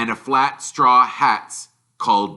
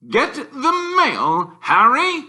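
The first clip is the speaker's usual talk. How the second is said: high, slow, louder caricature command; Uncle Vernon ordering; 5 dB louder, slower than usual. Acted. Uncle ordering Harry, high slow command. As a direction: lay it on as a pompous order.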